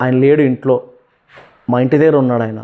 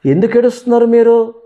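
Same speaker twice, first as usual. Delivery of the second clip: high, louder worried question; alarmed question; 9 semitones higher, 3 dB louder than usual. Retold or imitated. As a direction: ask your crying family why, startled. Asks crying family why.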